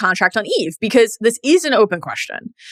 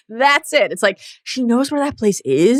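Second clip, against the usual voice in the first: usual speaking voice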